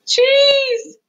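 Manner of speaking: high, loud, drawn-out squeal; stretched high-pitched exclamation; squealed reveal. A narrator's reading. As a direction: sing out the punchline up high. Chimes the story's refrain.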